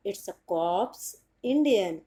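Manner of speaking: very slow Indian "cops" with p and s sounded; careful Indian English "cops". Shown as a demonstration. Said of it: "एक बार फिर से बता देती हूँ".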